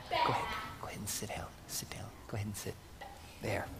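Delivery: soft, quick whisper, no voice. Real, not acted. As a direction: hush it, as if in church. Sending children back to seats.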